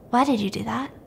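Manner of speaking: hushed, softly spoken accusation; understated ghostly reproach; eerie confrontation. Acted. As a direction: ask quietly, slowing on the final question. Ghostly victim confronts stepmother.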